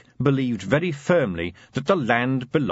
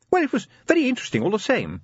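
breezy lady; 13 semitones higher, much faster than usual. A narrator's reading. Sybil brushes off husband.

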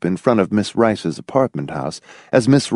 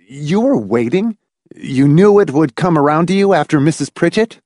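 incredulous demand, pitched up; 6 semitones higher, 3 dB louder than usual. A narrator's reading. Hamilton challenges Miss Rice about waiting her turn, demanding. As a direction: press the question, higher and insistent. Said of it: "Hamilton demanded".

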